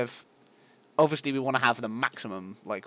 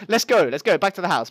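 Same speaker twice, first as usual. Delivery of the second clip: loud, raised, hurried call; rally everyone homeward; urgent rallying; 6 semitones higher, 9 dB louder than usual. Real, not acted. Player hurrying back to the house, loud urgent rallying.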